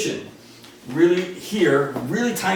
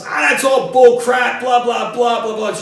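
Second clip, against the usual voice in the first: scornful dad shout; 8 semitones higher, 8 dB louder than usual. Retold or imitated. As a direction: wave it all away as bull crap. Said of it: "He says".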